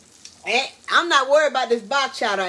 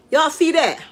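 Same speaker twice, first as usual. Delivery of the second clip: usual speaking voice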